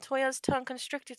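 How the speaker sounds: usual speaking voice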